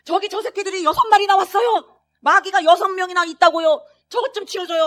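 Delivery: loud, high desperate yell; shouting for help. Retold or imitated. Father in the ICU yelling at the nurses, loud desperate shout. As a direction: yell at the staff to clear them away. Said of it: "아무리 외쳐도".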